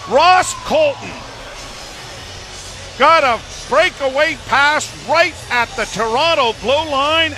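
excited goal call. Real, not acted. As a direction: call the breakaway goal, building fast.

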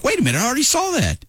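high, quick startled double take; surprised realization. Retold or imitated. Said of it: "and then I was like".

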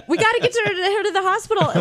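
high-pitched mock panic; freaked-out husband. Husband rushing wife out of baby shower, mock panicked yell. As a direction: blurt it in a high, frantic imitation. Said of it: "husband freaked out and yelled".

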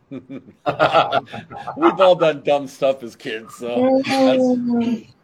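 slow, laughing through words. Real, not acted. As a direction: still chuckling as you speak. Laughing at kid story.

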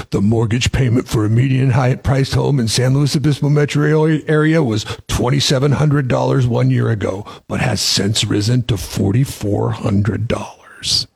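ominous announcer-style doom voice, slowing at the end; doom and gloom. Shown as a demonstration. Radio host reads a mortgage press release aloud, doom and gloom. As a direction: intone it like dire news, drawing out the final figure. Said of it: "my doom and gloom voice".

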